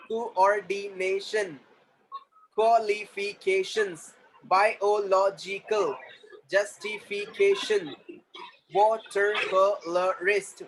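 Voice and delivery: very slow, separated syllables. Shown as a demonstration. Teacher modelling syllables for the class, syllable by syllable. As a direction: break the word into slow beats.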